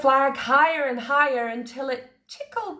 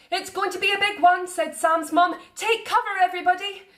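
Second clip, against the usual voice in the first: warning call; 7 semitones higher than usual. A narrator's reading. Mum warns.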